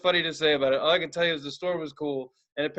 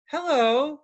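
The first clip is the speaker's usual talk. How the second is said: high sing-song hello called into the store; 10 semitones higher, 4 dB louder than usual. Retold or imitated. Calling out to an unseen shopkeeper, high sing-song. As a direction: call out brightly to someone unseen.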